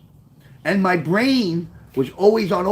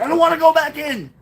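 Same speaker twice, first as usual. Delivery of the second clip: retold yell; 10 semitones higher than usual. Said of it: "And I said".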